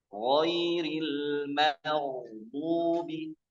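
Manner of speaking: Ghairil maghdubi very slow with a heavy gh; thick gh drawn out. Shown as a demonstration. Teacher reciting thick ghain slowly.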